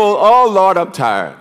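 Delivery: low, deflated whine of someone who is worn out; mock weary complainer. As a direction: sag into a lazy tired moan. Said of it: "You talk to some people".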